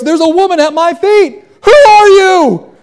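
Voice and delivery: high, loud panicked yelling; hammed-up alarm, dragging out the final shout; acted fright. Preacher as startled Boaz finding a woman at his feet, panicked. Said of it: "Bible says he was afraid".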